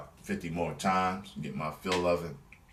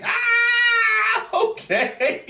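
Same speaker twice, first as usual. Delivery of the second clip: very high, loud laughing yelp; laughing shout; 10 dB louder, much slower than usual. Retold or imitated. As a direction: shriek with delighted laughter. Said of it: "And I was like".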